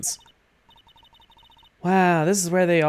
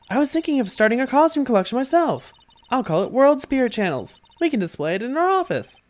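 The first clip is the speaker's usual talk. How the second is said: put-on higher, bright-eyed young sidekick; cheerful girl voice; 8 semitones higher than usual. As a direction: pitch it up and pitch an idea eagerly. Voices Maya character lines.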